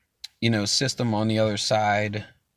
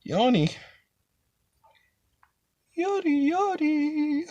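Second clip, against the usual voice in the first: squeaky falsetto, much higher, goofing around; silly high voice; slower than usual. Pretend. Host goofing with a playful word in a squeaky high falsetto. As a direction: drag each yawny up high and cute.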